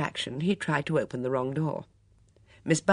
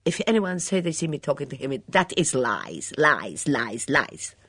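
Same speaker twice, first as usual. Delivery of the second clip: put-on scornful foreign accent, louder on the repeated lies; contemptuous; 5 dB louder than usual. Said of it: "she said contemptuously".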